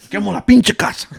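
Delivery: loud, high Spanish threat; mock a furious dad in Spanish; angry Spanish-speaking dad. Dad threatens his son in Spanish, angry and loud.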